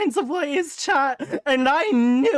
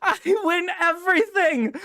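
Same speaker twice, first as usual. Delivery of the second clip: laughing and tearful; laughing gloat; 4 semitones higher, slower than usual. Real, not acted. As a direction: crow through laughter. Gloating over prediction.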